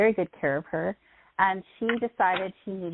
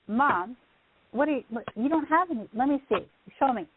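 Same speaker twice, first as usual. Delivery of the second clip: disbelieving surprise; 5 semitones higher than usual. Retold or imitated. Daughter to mom about her teeth, startled disbelief. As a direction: pitch jumps up in startled disbelief.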